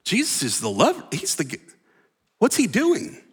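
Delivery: high, fast, incredulous outburst; a hammed-up what-is-he-doing; baffled protest. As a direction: play the confused disciple who can't believe it. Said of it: "And you're not going".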